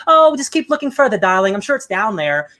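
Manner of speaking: flirty lady. Retold or imitated. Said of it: "she's like".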